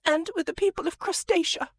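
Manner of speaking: high, loud tearful farewell; weeping lady. Acted. The weeping lady bids Prince Ampersand farewell in a high, loud tearful wail. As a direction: wail a teary goodbye, pitched high. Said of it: "weeping into her handkerchief".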